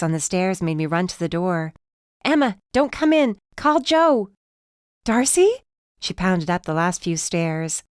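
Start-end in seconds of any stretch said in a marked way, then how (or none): 2.2-4.3 high, loud urgent call through the door. A narrator's reading. Darcy calls to Emma on the stairs, urgent and loud. 5.0-5.6 high, loud worried call; alarmed call. A narrator's reading. Emma calls up to Darcy, high and alarmed.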